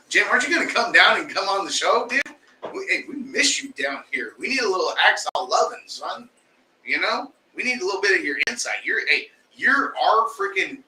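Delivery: teasing, begging call-out; playful pleading. Real, not acted. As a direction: coax him onto the show. Pleading for a guest to come.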